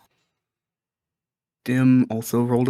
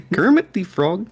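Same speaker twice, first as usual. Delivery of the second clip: Kermit voice; 9 semitones higher, 4 dB louder than usual. Acted. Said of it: "Do it".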